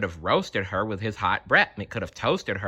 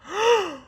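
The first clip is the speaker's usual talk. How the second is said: sharp high gasp of shock; crowd gasp. Acted. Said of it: "the crowd gasped".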